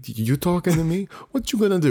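light gangster impression, teasing; a playful New York Italian tough-guy challenge; mock Italian tough guy. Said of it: "You went Italian halfway through that".

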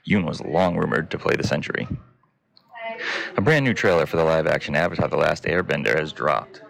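low, even newsreader voice; news read. Acted. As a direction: read the headlines like a news anchor.

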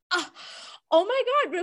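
gasped, high-pitched mock amazement; imitating genuine excitement. Guest imitating a TV host meeting a bug scientist, excited. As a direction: gasp like a thrilled TV host. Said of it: "he's actually so genuinely excited".

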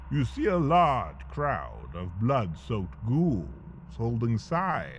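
ordinary delivery

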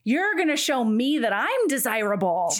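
mock-haughty demand. Heroine telling him he must seduce her, mock-haughty demand. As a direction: put on her demanding voice, stretching it out.

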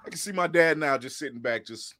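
normal talking voice

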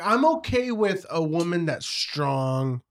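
normal talking voice

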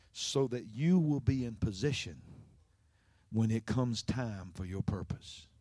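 slow, low, soft sermon delivery; hushed preaching. Real, not acted. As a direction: let the point land gently, pausing between phrases.

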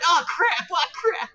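put-on high-pitched panicked yelp in a Minnesota accent. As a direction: imitate a Minnesotan woman yelling in panic. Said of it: "Minnesota accent that's just so panicked".

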